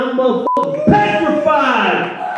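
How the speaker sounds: usual speaking voice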